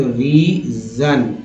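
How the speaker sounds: रीजन stretched out very slowly; very slow pronunciation. Shown as a demonstration. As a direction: draw out रीजन for learners. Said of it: "जीएन को जन बोलते हैं". (रीजन को बहुत धीरे खींचकर बोलें)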